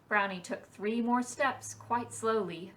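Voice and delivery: plain reading voice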